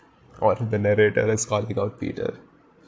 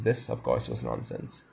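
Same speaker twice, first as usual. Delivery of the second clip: much lower, quieter, judging tone; deep narrator voice; 9 semitones lower, 7 dB quieter than usual. Shown as a demonstration. Reader voicing the narrator calling out Peter, low and dry. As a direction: drop the voice low like a stern narrator. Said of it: "the narrator calls it out".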